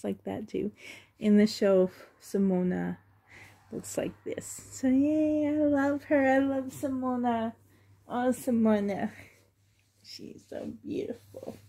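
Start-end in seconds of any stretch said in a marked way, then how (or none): 3.7-4.6 hushed whisper over the doll. Real, not acted. Showing the doll softly. 10.0-11.6 awed, voiceless whisper. Real, not acted. Admiring the doll softly.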